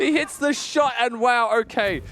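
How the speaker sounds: high, loud amazed call; esports caster. Real, not acted. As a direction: shout the kill in disbelief. Caster calls the kill live.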